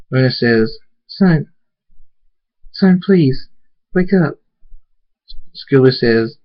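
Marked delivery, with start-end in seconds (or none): 1.1-4.4 higher, halting motherly plea; anxious, raised entreaty; worried pleading. Acted. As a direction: beg your fallen son to wake. Mother pleads with collapsed son.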